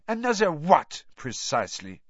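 icy, high French lady; cold and cutting. A narrator's reading. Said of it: "her tone icy".

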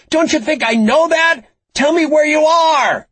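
very high, frantic full-force shout. A narrator's reading. Frankie yells out the window at the unseen captor, shouting. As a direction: shout at the top of your voice, desperate. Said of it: "she shouted out the window".

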